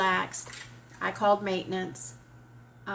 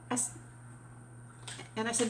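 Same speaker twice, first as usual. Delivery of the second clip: ordinary delivery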